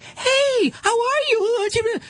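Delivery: put-on very high, gushing baby-talk hello; mocking people who greet dogs excitedly. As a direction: coo at the dog like an overexcited stranger. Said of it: "they have an excited sound".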